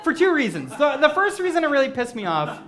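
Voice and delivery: ordinary talk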